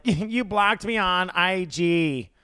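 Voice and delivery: high, slow mock complaint; Carol. Host imitating a reality star's grievance, high drawn-out mockery. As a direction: drag it out in a mocking impression. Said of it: "Carol being like".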